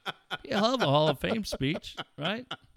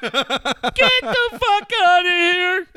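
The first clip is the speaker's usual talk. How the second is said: slow, stretched, shouted, much higher; home run call; 11 semitones higher, 7 dB louder, much slower than usual. Retold or imitated. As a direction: bellow the call as the ball leaves the park. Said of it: "They didn't like my home run call".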